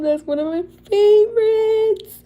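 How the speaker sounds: high, loud fangirl squeal; gushing squeal. Real, not acted. Showing viewers a favourite custom, gushing squeal. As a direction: squeal it, climbing high on the last word.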